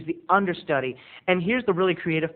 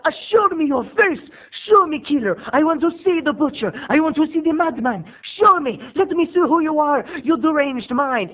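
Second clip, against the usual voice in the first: defiant shouting; 10 semitones higher, 6 dB louder than usual. Retold or imitated. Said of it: "she's saying to the killer".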